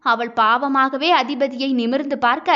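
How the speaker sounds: ordinary delivery, nothing marked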